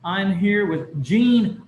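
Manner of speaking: ordinary delivery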